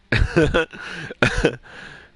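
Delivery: loud high laugh; sudden real laugh; burst of laughter. Real, not acted. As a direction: crack up at yourself. Laughing at own daydream quote.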